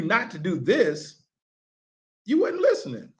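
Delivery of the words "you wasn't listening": mock scolding. Coach retells scolding players.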